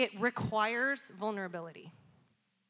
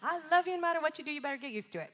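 mom's loving comeback; 6 semitones higher, faster than usual. Retold or imitated. Mom answering her small son's rejection, high sing-out reassurance. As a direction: imitate a mother cooing back at her child. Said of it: "And my response is".

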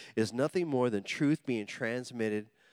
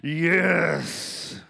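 gleeful cackle; 4 semitones higher, 7 dB louder than usual. Retold or imitated. Gleeful prayer, mocking tone.

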